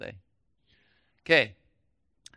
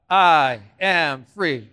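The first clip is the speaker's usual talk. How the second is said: slow declaration; 4 semitones lower, 5 dB louder, much slower than usual. Shown as a demonstration. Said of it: "Nice and loud".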